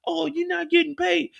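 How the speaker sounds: high whiny mock of a complaining woman; mocking baby mama. Gold cloak parroting baby mama's complaint in high mock voice. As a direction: pitch it up into a nagging whine. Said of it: "and you talking about".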